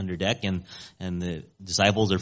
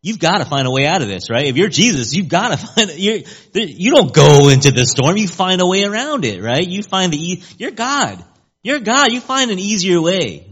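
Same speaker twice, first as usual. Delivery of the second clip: higher-pitched, animated voice arguing for the easy way; incredulous Epicurean; 7 semitones higher, 6 dB louder than usual. Acted. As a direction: play an Epicurean urging an escape, pitched up. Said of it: "To an Epicurean".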